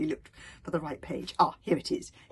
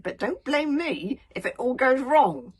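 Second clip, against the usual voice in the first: slow, loud strained warning; through gritted teeth; 7 semitones higher, 12 dB louder, slower than usual. A narrator's reading. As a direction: grind it out holding the temper. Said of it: "through very gritted teeth".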